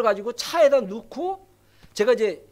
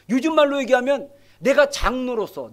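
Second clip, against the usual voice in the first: usual speaking voice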